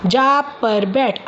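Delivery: very slow, deliberate articulation; slow practice reading. Shown as a demonstration. Speech teacher reads practice sentences to a child, very slow. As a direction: sound out each word for a child.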